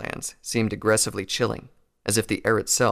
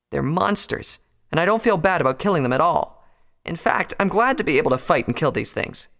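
higher-pitched, hardened insistence; bitter defiance; 7 semitones higher than usual. A narrator's reading. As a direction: defend yourself without apology, voice raised. Rainbow defends killing monsters.

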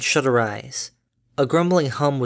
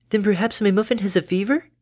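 mock gasp; 8 semitones higher than usual. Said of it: "exclaimed with a mock gasp".

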